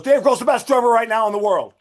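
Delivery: high, loud challenge, imitating a pushy guy; retold in-your-face declaration, loud and high; aggressive insistence. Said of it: "like, really aggressive".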